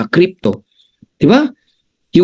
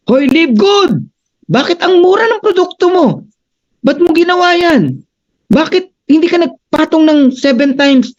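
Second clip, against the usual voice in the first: much higher, mock indignant scolding; angry rival company; 11 semitones higher than usual. Rival MLM scolding Live Good over cheap prices, high mock outrage. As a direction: play the outraged overpriced competitor, pitched way up. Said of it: "Magagalit yan".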